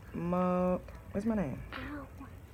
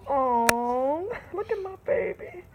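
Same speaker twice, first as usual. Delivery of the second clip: cooing; 5 semitones higher than usual. Real, not acted. Mother coos at her daughter's baby picture.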